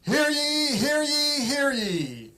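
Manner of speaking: high proclaiming call; town crier. Acted. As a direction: cry it out like a herald. Reading the proclamation aloud.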